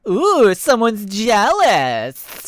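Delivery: high, slow, drawn-out teasing. A narrator's reading. Majira teasing a jealous Nick, slow and high. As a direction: stretch it out to needle her. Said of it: "Majira teased".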